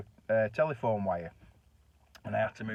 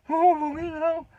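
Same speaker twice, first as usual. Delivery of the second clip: much higher, loud shocked cry; horrified oath; 7 dB louder, slower than usual. Retold or imitated. Husband horrified at the dead body idea, loud shocked cry. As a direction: recoil in horror.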